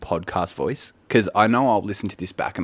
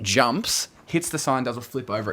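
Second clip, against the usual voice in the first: usual speaking voice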